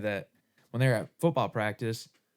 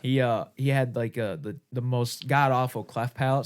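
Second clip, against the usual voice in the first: ordinary delivery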